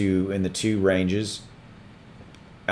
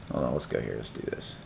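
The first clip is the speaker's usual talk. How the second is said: muttering to self; 10 semitones lower, 11 dB quieter than usual. Real, not acted. Muttering while searching.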